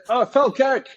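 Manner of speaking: high-pitched fake Scottish accent; put-on Scottish accent. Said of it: "I put on Scottish".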